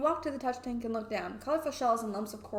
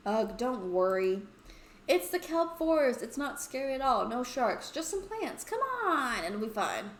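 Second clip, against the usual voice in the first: coaxing friend Misha; 3 semitones higher, 4 dB louder than usual. Acted. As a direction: talk a nervous friend into it, pitch swooping up.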